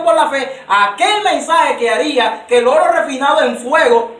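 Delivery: loud, high scripture proclamation; fiery preaching. Acted. A preacher reading the Lord's rebuke to Laodicea, loud and high. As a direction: thunder the verse at the church.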